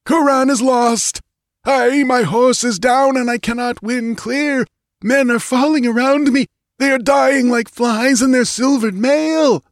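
high, panicked battlefield report; screaming. A narrator's reading. Fallen priest reports the slaughter to his queen, screaming. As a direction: scream the bad news in desperate high pitch. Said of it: "screamed the skull-like apparition".

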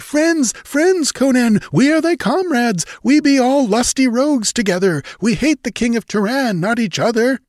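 very high pirate voice; eager pleading. A narrator's reading. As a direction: placate the captain in eager, high cries. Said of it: "they cried eagerly".

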